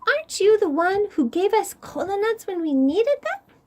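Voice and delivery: tiny ant voice. Acted. Ant greeting Koi in a tiny high voice. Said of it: "it said".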